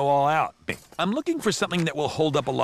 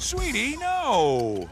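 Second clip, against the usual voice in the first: emphatic denial; 12 semitones higher, 3 dB louder, much slower than usual. Acted. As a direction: deny it emphatically to your girlfriend. Answering girlfriend's do you care.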